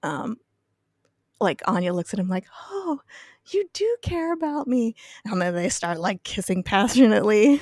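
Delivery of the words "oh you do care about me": high breathy swoon. Retold or imitated. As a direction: gush it like a smitten girl. Anya swooning at Xander.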